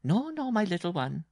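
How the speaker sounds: high-pitched, teasing bride voice; amused bride. A narrator's reading. Bride refusing her little husband, high and amused. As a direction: tease him up high, without laughing outright. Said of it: "she laughed".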